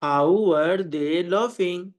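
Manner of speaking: very slow, drawn-out words; slow model sentence. Shown as a demonstration. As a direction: model it slowly for learners. Teacher models translation slowly. (धीरे-धीरे, शब्द खींचकर)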